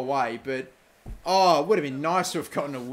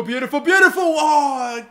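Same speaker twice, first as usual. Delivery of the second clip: loud, higher, laughing praise; delighted laughing; 10 semitones higher, 8 dB louder than usual. Real, not acted. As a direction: laugh with joy at the move. Delighted at good play.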